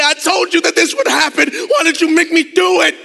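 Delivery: high, loud raging yell; furious shout. Retold or imitated. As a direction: scream it at her, out of control. Enraged boy yells at teacher.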